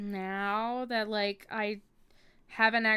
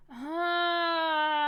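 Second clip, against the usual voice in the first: startled; 6 semitones higher, 8 dB louder than usual. Real, not acted. Sudden startled cry.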